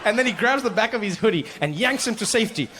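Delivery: normal talking voice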